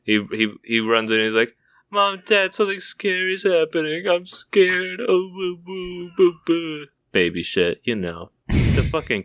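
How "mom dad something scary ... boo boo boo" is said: high, mock-sobbing child; hammed-up boohoo kid voice; scared crying kid. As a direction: blubber like a frightened kid.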